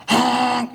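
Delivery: long, high-pitched honk; drawn-out mimicked horn blast; imitated car horn. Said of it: "who's honking at me".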